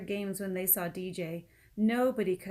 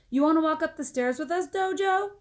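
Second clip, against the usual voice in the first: put-on fast child voice; 9 semitones higher, 8 dB louder than usual.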